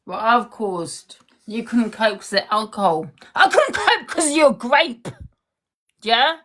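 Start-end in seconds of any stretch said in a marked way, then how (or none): 3.3-6.4 high, loud furious retort; angry shout. Real, not acted. Firing back at her ex's excuse, shouting high and loud. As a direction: throw his excuse back at him, yelling.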